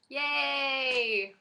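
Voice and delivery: long, high drawn-out yay; high-pitched celebratory squeal of a word; celebratory cheer. Real, not acted. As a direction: stretch it into a happy little cheer. Celebrating her baby news.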